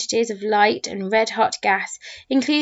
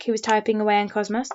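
ordinary delivery

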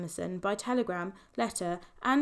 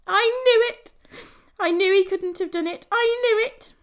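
much higher, much louder shout of triumph; wild joy; 12 semitones higher, 10 dB louder than usual. A narrator's reading. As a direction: cry it out, half laughing, half in tears. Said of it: "gave a wild chuckle, and a tear".

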